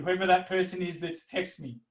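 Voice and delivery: normal talking voice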